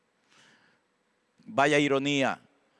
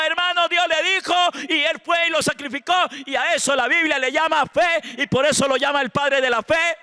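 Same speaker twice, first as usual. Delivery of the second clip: loud, high-pitched sermon at full force; fervent preaching; 10 semitones higher, 6 dB louder than usual. Acted. Pastor rebuking false faith to the congregation, impassioned loud preaching. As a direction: preach it with raised, driving intensity.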